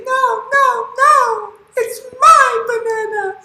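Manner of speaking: very high, loud squealing monkeys; protesting monkeys. Acted. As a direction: squeal it like indignant little monkeys. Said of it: "the monkeys, they say".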